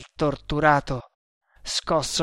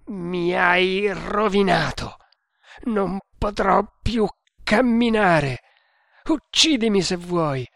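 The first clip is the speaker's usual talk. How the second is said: tortured prisoner's raised, bitter voice; anguished defiance; 5 semitones higher, 4 dB louder than usual. A narrator's reading. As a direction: throw the accusation at your torturer. Tortured prisoner defies his captor.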